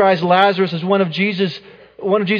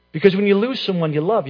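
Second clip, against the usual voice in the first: normal talking voice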